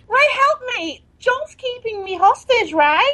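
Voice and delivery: pleading. Acted. Pleading ex begging on phone.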